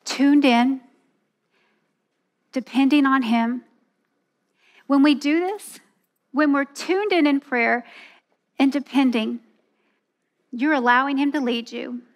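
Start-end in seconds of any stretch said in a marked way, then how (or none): none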